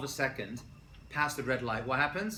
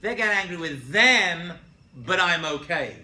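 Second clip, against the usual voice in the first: slow, louder, smug; smug self-excuse; 4 semitones higher, 10 dB louder, slower than usual. Retold or imitated. Teacher mocking people who excuse their own anger. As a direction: drag it out like a self-satisfied excuse. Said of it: "they don't think".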